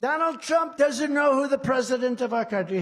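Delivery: mocking the press, a loud headline yell. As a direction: trumpet it like a breaking headline.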